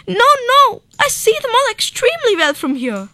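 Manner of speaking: high, fast, panicky pleading; dentist crying in despair. A narrator's reading. Dentist pleads with the crocodile, high and panicky. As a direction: beg off in a rush, frightened. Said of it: "weeping in despair, he cried".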